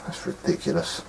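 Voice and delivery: whispered aside, unvoiced and soft; muttered under breath. Real, not acted. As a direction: mutter it to yourself in disbelief. Muttered aside at the bad game.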